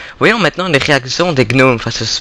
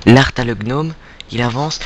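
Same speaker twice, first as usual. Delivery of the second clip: ordinary talk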